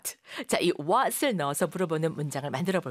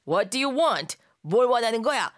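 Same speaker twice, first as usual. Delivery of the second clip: blunt demand; 4 semitones higher, 5 dB louder, slower than usual. Shown as a demonstration. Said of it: "이렇게 많이 묻죠".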